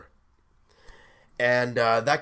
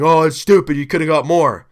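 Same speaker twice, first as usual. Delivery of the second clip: mimicking complaining fans, much louder; loud mock complaint from naysayers; mocking critics; 8 dB louder, faster than usual. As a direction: parody the know-it-all doubters. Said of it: "Everyone's saying".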